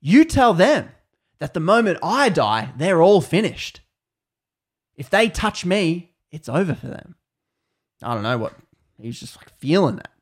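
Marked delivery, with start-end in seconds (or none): 0.0-7.2 louder, higher defiant vow. Retold or imitated. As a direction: hurl it back with bold conviction. Newly converted man defies threat.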